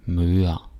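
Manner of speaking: ordinary delivery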